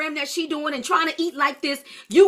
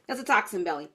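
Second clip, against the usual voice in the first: "toxin belly" said again lower and softer, driving it home; lower, softer repeat; 6 semitones lower, 6 dB quieter, faster than usual. Shown as a demonstration. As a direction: drop the voice and let "toxin belly" land.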